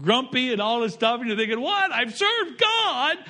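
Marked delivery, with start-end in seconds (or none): 1.6-3.2 high, drawn-out disbelief; slow, higher wounded whine; indignant complaint. Retold or imitated. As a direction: protest that serving God should spare you. Complaining believer quoted.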